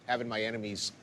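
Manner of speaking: normal talking voice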